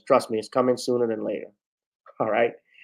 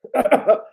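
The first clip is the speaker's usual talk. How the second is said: laugh; 12 semitones higher, 4 dB louder than usual. Real, not acted. Laughs at own impression.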